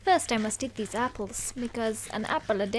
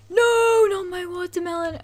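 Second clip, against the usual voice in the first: high, loud cry of no; dismayed shout; 6 semitones higher, 7 dB louder than usual. Real, not acted. Player seeing the watermelon ruined, loud dismay. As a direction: wail at the loss.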